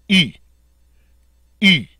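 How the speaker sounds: "E" as long ee through rounded lips; French u, ee said with lips rounded as "E". Shown as a demonstration. Teacher shows French letter U, rounded-lip E. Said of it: "a long E sound spoken through rounded lips".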